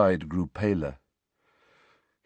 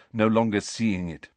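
ordinary delivery, nothing marked

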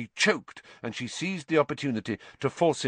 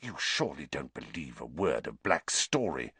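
low, soft, near-whispered disbelief; 8 semitones lower, 6 dB quieter than usual. A narrator's reading. Professor challenging headmaster's belief, whispering. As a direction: lower the voice to an intense murmur. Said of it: "Snape whispered".

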